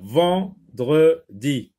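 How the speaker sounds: "Vendredi" slow, split into three separate syllables. Shown as a demonstration. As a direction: break "Vendredi" into ven, dre, di, stressing each. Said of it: "on sectionne le mot vendredi en syllabes".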